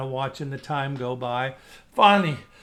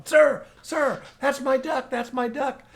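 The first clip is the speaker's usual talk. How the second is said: higher, louder urgent calling; 9 semitones higher, 4 dB louder, slower than usual. Retold or imitated. Hunter calling to farmer over the trees, urgent higher shout. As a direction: shout across to get the farmer's attention.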